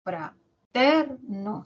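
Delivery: "Fraterno" very slowly split as fra-ter-no; syllable by syllable. Shown as a demonstration. Teacher looking for the stressed syllable, "Fraterno" syllable by syllable. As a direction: draw out "Fraterno" one syllable at a time. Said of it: "Sílaba tónica".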